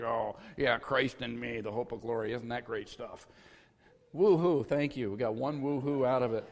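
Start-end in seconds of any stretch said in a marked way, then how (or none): 0.0-3.3 mock unimpressed, softer Christ in me; bored congregation. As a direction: parrot it back with no enthusiasm. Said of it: "everybody's like".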